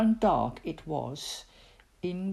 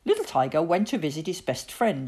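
ordinary delivery

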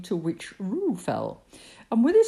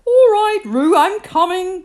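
high, loud rescue call; 13 semitones higher, 11 dB louder than usual. A narrator's reading. Christopher Robin runs to rescue Roo, calling loudly. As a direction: shout it to someone far away. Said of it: "called Christopher Robin".

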